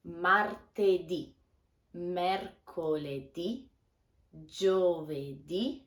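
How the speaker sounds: martedì, mercoledì, giovedì slow, each final ì stressed. Shown as a demonstration. Teacher slowly stressing the final ì for learners. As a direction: stretch each day, leaning on the last ì. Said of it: "be careful to pronounce lunedì with the accent on the last vocal".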